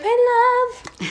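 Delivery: high breathy swoon; a high, dreamy "My love"; sultry Lilith. Retold or imitated. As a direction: coo it like a lovesick temptress. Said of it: "she's like".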